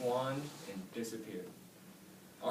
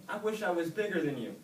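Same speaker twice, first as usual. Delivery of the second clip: higher, louder urgent plea; desperate wish; 5 semitones higher, 6 dB louder than usual. A narrator's reading. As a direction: build to a loud much bigger. Oscar wishing in panic.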